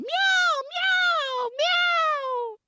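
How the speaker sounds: very high, drawn-out meows; exploding cat meows. Retold or imitated. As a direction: burst into squealing cat cries. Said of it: "cats just explode into meows".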